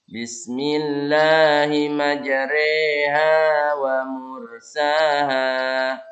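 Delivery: "majreha" with the ra leaned toward e, slow; imalah. Shown as a demonstration. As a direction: tilt the vowel of "majreha" to e. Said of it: "Malah ya, mereng".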